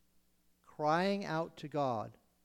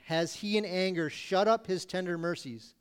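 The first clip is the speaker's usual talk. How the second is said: ordinary talk, nothing marked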